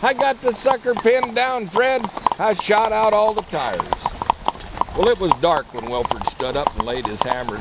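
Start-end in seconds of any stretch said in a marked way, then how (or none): 0.0-3.8 excited shout. Acted. Character shouts to Fred.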